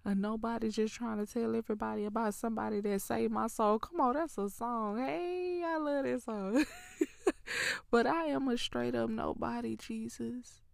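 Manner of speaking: fast and loud, bursting with joy; ecstatic praise. Real, not acted.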